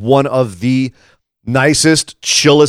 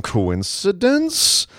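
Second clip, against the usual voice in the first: drawn-out, softer, higher-pitched questioning; mock suspicion; 8 semitones higher, 6 dB quieter, much slower than usual. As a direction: stretch the word out as if feigning suspicion. Mock suspicion about scheduling.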